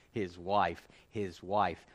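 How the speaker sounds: "his wife" repeated with a falling hit on "wife"; stress on wife. Shown as a demonstration. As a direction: hammer "wife" each time. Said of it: "keeps emphasizing his wife".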